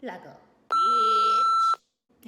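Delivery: high, loud, drawn-out squeal on bitch; shrieked punchline. Pretend. Mocking a man who feels weak, squealed high and loud. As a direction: squeak it out in a falsetto.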